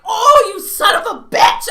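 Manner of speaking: high, very loud mock outrage; playful retold shout, drawn out; outraged yell. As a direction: explode as if cheated in a game. Acting out losing the name game.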